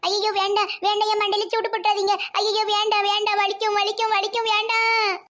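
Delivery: frantic repeated begging, pitch creeping up; panicked pleading. Acted. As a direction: beg them to stop before it hurts. Mother begs not to be branded.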